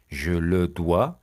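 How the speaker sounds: each syllable drawn out slowly for learners; slow model phrase. Shown as a demonstration. French teacher to students, slow model read. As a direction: say it slowly and clearly.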